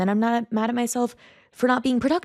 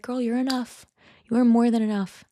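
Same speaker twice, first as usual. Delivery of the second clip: encouraging pep talk; 5 semitones higher than usual. Retold or imitated. Answering her inner critic kindly.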